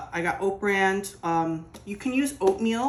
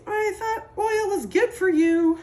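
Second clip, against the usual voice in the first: high, louder mocking voice; mocking quote; 6 dB louder than usual. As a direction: mimic a naive dieter, high-pitched. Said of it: "I know people say".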